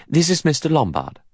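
high-pitched, efficient introduction; lightly businesslike; competent. A narrator's reading. Secretary introduces her companion, competently. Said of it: "in a competent manner".

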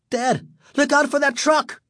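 high, alarmed cry without much volume; screamed warning. A narrator's reading. Son to dad as car swerves, screaming warning. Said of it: "Greg screamed".

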